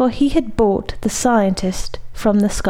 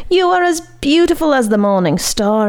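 howling; 6 semitones higher than usual. Acted. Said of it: "howled the Scarlet Bone".

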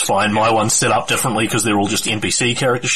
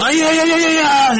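high-pitched battle cry; 4 dB louder, faster than usual. Acted. Game master voices a character charging into battle, crying out. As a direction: whoop out a wild, high war cry. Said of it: "Cries out".

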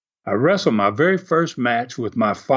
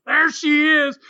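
high, triumphant call-out; pointing heel; 11 semitones higher than usual. Retold or imitated. Heel wrestler pointing out the grandmother in the top row, triumphant. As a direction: spot her and announce it to the crowd. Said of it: "he pointed at her, and he goes".

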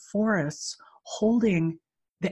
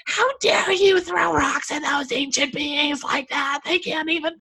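crazy-person tirade; 9 semitones higher than usual. Retold or imitated. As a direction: re-enact berating a child in a high put-on voice. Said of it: "A crazy person".

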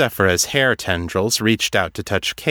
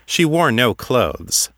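usual speaking voice

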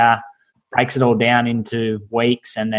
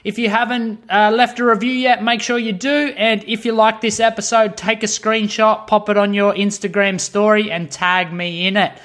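higher, upbeat host sign-off read; podcast outro; 8 semitones higher than usual. Acted. Host signing off the episode to listeners, higher upbeat outro read.

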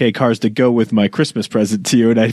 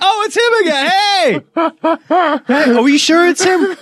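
high staff voice. Pretend. Voicing the truck stop staff.

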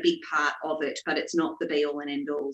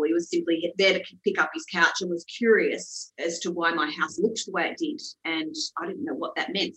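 usual speaking voice

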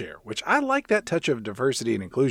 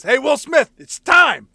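louder, higher hammed-up summons; mock call out; 10 semitones higher, 6 dB louder, slower than usual. Corrupt cop calling Will Smith outside, louder mock summons. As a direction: call him out across the lot.

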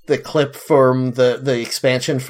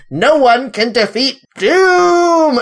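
booming supervillain voice, higher and slow; megalomaniac rant; 9 semitones higher, 4 dB louder, slower than usual. Retold or imitated. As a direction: proclaim it like a cartoon villain. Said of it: "So now he's like".

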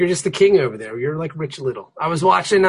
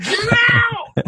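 high, drawn-out retold shout; startled shout. Dad to child who yelled at him, startled high shout. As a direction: act out a startled parent shouting back. Dad's reaction to being yelled at.

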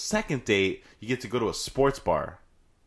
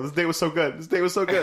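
mock gushing; 8 semitones higher than usual. Repeated excited line.

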